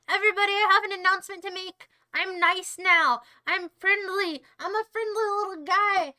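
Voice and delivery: loud, over-sweet announcement building into a threat; forced friendliness. Pretend. Streamer announcing he is nice now, forced friendliness. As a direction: overdo the friendly act until it boils over.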